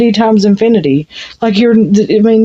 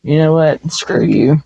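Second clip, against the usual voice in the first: dismissive; 4 semitones lower, 4 dB quieter than usual. Retold or imitated. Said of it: "where you're just like".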